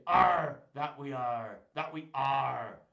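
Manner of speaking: loud pirate puppet arr, slow at the end; pirate growl. Pretend. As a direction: answer like a cheery pirate.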